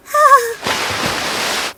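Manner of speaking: long high swooning sigh; high dreamy sigh. Acted. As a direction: sigh like a smitten fairy.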